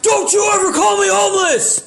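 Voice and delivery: furious warning from Homer; angry Homer. Retold or imitated. Homer warning the comic, furious shout. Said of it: "and Homer said".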